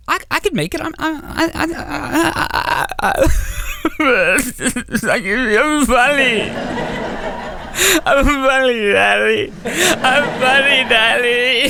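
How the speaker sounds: put-on high, tearful little-boy whimper with broken stutters; pleading child's fake crying; mock boo-hooing. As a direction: blubber it out in a high, hammed-up sob. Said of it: "Stop your fucking boo-hooing".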